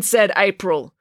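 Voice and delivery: plain reading voice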